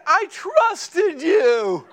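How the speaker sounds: high, very slow, through laughter; laughing mock hurt. Wife betrayed after losing her money, high laughing wail.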